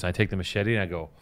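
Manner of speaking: ordinary talk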